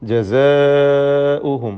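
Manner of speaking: جزاؤهم with a long, loud held aa; obligatory madd. Shown as a demonstration. Teacher shows students required lengthening, جزاؤهم held long. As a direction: stretch the aa of جزاؤهم right out. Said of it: "മദ് മുത്തസിൽ വാജിബ്".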